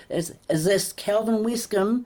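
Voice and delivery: high, slow, put-on formal caller; phone operator voice. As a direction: play the careful stranger on the phone. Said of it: "And it's like".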